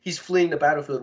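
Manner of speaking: ordinary delivery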